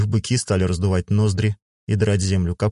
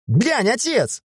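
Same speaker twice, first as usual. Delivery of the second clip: put-on high, eager girl calling to her father; excited shout; slower than usual. A narrator's reading. Miya calls her father over to the bike, high excited shout. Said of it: "закричала Мия".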